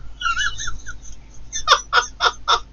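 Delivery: long, very high-pitched cackle; high squealing laugh. Real, not acted. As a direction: break into squeaky, repeated peals. Laughs at throwing sofa out.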